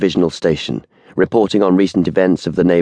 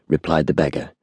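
ordinary delivery, nothing marked

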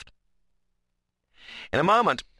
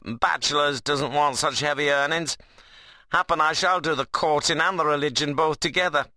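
teasing rustic dialect, pitched up; Ben, cheeky workman; 7 semitones higher than usual. Acted. Ben puts off religion to Seth, jauntily teasing. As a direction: play a jaunty bachelor ribbing his mate. Ben's figure voice.

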